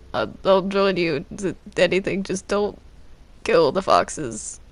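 ordinary talk

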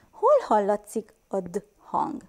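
normal talking voice

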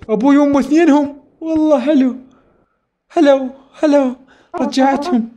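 high, excited celebrating; joyful. Real, not acted. Player cheering rescued parents, excited. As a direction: cheer at saving both parents.

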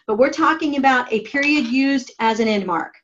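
usual speaking voice

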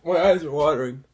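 laughing through tears, high pitched; teary laugh from the burn; teary from hot sauce. Real, not acted. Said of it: "I'm literally crying".

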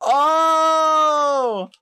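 long, high, loud "oh!"; high-pitched whoop of a held "oh"; excited "oh" cry. Real, not acted. Thrilled outburst at own prank.

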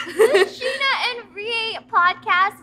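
slow, laughing podcast intro; laughing announcement. Real, not acted. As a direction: announce the show through laughter. Laughing show title announcement.

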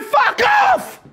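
very high bellowed keeper's call; shouted goalie call. Retold or imitated. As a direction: yell it like a keeper clearing his box. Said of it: "You should be going".

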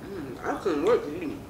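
talking while chewing chicken; mumbling around food; mouth full, chewing. Real, not acted. Seen 1: Both women bite and chew wings throughout; speaker unclear.

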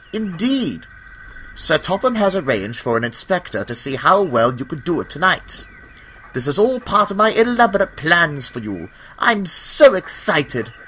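a lord sharing his big plans; eager Sir Robert. A narrator's reading.

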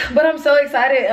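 a laugh running through the words; bubbling over with giddy joy; laughing excitement. Real, not acted. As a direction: giggle it out with excitement.

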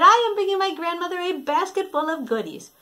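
put-on high, quick girlish chatter; eager little Red. Red telling the wolf about grandmother, quick and high. Red explains her errand.

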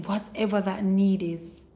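ordinary talk, nothing marked

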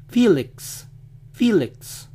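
"Felix" as "FEE-lix"; American "Felix", stressed "FEE"; English pronunciation. Shown as a demonstration. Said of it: "lo dicen".

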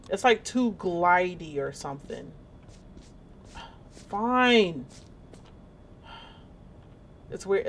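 ordinary delivery

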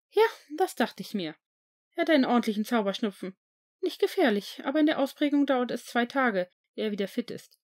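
a nurse giving her verdict; school nurse. A narrator's reading. Nurse diagnosing. (Krankenschwester, höher und zügig)

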